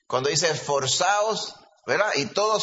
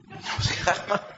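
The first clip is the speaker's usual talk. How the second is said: breathy laughing under the breath; no cejaba said in a whispered laugh; 3 semitones higher, 3 dB quieter, slower than usual. Retold or imitated. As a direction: whisper it through a giggle. Repeats it, laughing.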